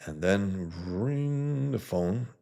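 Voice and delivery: slow, drawn-out phone ringing line; slow dramatic read. Acted. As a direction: stretch it out like a suspense beat. Dramatic reading.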